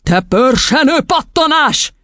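high, slow, furious yell; roaring insult. A narrator's reading. Headmistress at the counting boy, roaring. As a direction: spit out each word in rage. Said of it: "ordította Doron kisasszony".

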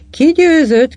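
loud, high-pitched asking; eager question. A narrator's reading. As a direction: ask who won, voice leaping up. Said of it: "kérdezte Mrs. Walker".

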